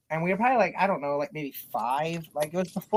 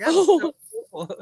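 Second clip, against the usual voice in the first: high, loud gleeful exclamation; imitated childish delight; thrilled kid; 9 semitones higher, 6 dB louder than usual. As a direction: gush like an overexcited child. Retold kid thrilled after danger.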